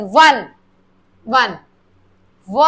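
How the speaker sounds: वन said low, then cut to a bare व; isolating the w sound in वन. Shown as a demonstration. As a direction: break वन down to its first sound. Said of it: "vowels का sound नहीं आ रहा".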